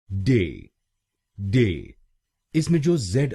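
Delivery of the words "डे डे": "डे" with no z sound; syllable demo. Shown as a demonstration. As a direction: say "डे" cleanly, the z stays silent. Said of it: "बोलेंगे". ("डे" बोलिए, जेड silent)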